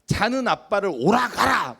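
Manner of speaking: mock the irritated dad snapping back; father yelling back. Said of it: "소리를 질러요".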